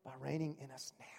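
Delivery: soft whispered close to the point. Real, not acted. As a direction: drop to a hush for emphasis.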